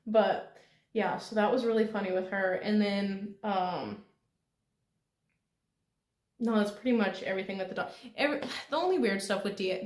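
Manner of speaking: ordinary talk, nothing marked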